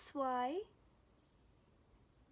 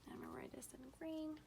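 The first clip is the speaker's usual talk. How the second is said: soft, quick half-whispered aside; quiet aside; 4 semitones higher, 9 dB quieter, much faster than usual. Real, not acted. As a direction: murmur it quickly while writing. Aside while writing on board.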